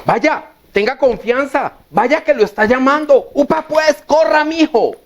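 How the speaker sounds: high-pitched urging, building to a cheer; encouraging crowd. Retold or imitated. Bystanders urging the beggar to go to Jesus, acted eager encouragement. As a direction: egg someone on, pitch climbing toward the end. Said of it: "Pasaron a animarlo".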